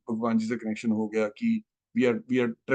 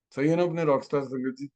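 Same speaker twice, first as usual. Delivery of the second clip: usual speaking voice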